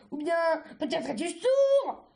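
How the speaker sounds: loud, exasperated taunt; angry yell. A narrator's reading. Cercerelle yelling in Argile's ears, angry yell. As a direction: build to a shouted jab. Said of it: "hurla Cercerelle de, dans les oreilles".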